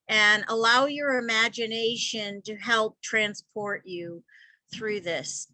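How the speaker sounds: ordinary talk, nothing marked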